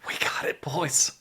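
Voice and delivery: soft, breathy, half-whispered triumph; mock choked-up whisper. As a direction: play it teary and barely audible. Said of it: "a little choked up".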